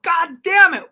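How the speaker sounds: high, loud frustrated cursing; acted exasperation. Comic reliving his hijacked showcase, loud mock exasperation. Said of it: "I'm like".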